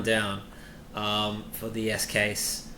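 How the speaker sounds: ordinary delivery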